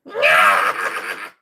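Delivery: short loud shriek. Pretend. Streamer shrieks while mocking his mods, loud shriek. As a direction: let out a sudden cry. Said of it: "It's a really loud noise to make at midnight".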